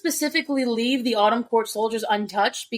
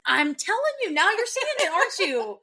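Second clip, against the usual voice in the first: high-pitched, crowing told-you-so; gleeful told-you-so; 8 semitones higher than usual. Real, not acted. Host to co-host who finally sees her theory, high and gloating. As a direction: crow it in a high, lilting voice.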